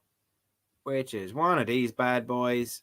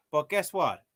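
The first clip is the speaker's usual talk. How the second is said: ordinary delivery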